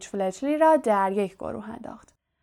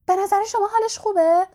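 squeaky, very high anxious voice; chirpy, cricket-like squeal; Hermione; 11 semitones higher, 5 dB louder than usual. A narrator's reading. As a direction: squeak the question out, louder and much higher. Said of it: "با صدای جیر جیر مانندی گفت".

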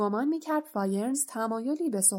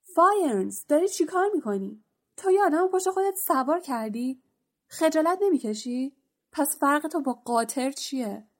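loud, high indignant yell, easing off at the end; furious centaur shouting across the clearing; 5 semitones higher, 3 dB louder than usual. Acted. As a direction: bellow at him in outrage. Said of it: "بین فریاد زد".

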